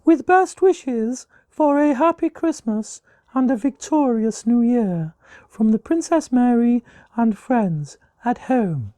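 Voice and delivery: high, slow, silly royal lady voice that starts as a whisper; silly Princess Mary voice. Acted. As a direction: play a prim princess reading her card, way up high and slow. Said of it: "Do it in my best Princess Mary voice".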